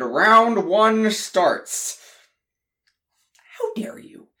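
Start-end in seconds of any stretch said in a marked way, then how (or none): 3.6-4.3 hissed whispered outrage; angry whisper. Acted. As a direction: whisper it with fury. Round one of brothers' fight.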